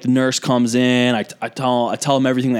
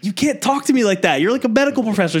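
high, flustered protest; comic replay of being affronted; retold indignation; 9 semitones higher than usual. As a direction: object in scandalized disbelief, pitched up.

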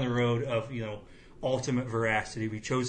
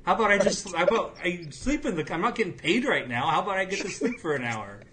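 put-on higher, louder whiny complaint, like a sleepy kid begging; whiny teen protest; 7 semitones higher, 4 dB louder than usual. Kid on a job whining to dad, higher and louder. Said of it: "It's like".

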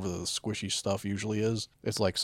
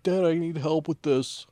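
put-on higher, louder pleading little kid; whiny kid; 8 semitones higher, 5 dB louder than usual. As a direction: beg dad like a small child. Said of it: "was like".

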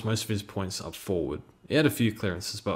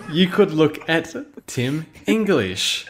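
laughing through the words; can't keep a straight face; 6 semitones higher, 7 dB louder, slower than usual. Real, not acted. Cracks up mid-sentence.

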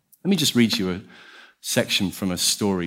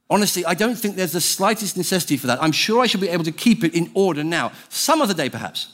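higher, protesting ghost voice; flustered ghost; 7 semitones higher than usual. Acted. Ghost objecting to the angel's offer to kill, flustered. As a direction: voice a ghost backing out, pitched up.

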